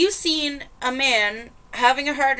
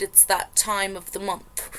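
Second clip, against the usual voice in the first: whisper. Pretend. Whispered quote inside the reading.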